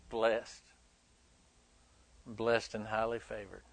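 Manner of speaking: quiet, dropped-low churchy autopilot; hollow pious reply, low and soft; mock lip service. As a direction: rattle it off like empty routine. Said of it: "just giving God lip service".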